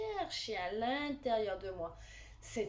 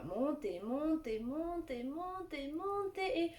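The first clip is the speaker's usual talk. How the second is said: climbing higher with each word; rising build; 7 semitones higher than usual. Shown as a demonstration. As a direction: build pitch up step by step. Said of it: "je fais monter dans mon poing".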